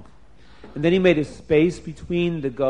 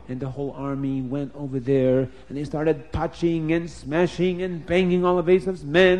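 ordinary delivery

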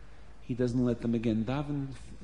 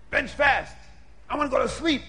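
high-pitched, bossy order; shrill-pitched demand to hurry; impatient shout; 9 semitones higher than usual. Acted. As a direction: bark it out, rushing them along. Impatient host rushing guests.

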